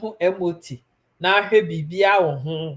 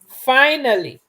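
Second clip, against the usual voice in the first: higher, louder drawn-out finally; teasing, raised voice to Eva who finally woke; loud relieved call; 8 semitones higher, 9 dB louder, slower than usual. Real, not acted.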